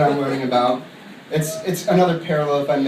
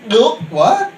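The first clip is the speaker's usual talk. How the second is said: higher, louder, laughing "what"; incredulous at the mani-pedi; mock disbelief; 8 semitones higher, 5 dB louder than usual. Hearing of the guy's mani-pedi, laughing disbelief.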